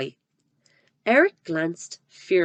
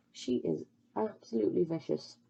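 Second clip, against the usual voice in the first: much softer murmured comment; hushed aside; 9 dB quieter than usual. Real, not acted. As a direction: drop out of the story to remark. Reader's aside on Trunchbull.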